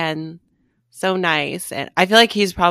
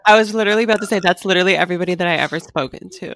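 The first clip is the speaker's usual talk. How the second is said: louder, racing excitement; excited fast reply; 2 semitones higher, 8 dB louder, much faster than usual. Real, not acted. Friend jumping in excitedly to admit her own catfishing, fast and loud. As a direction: blurt it out before she finishes.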